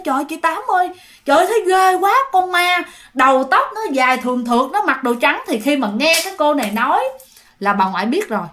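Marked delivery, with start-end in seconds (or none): 0.0-5.4 frightened telling. Acted.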